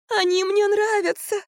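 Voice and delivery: very high, drawn-out whine; moaning. A narrator's reading. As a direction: wail it plaintively. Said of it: "простонала Марфа". (протяжный жалобный стон)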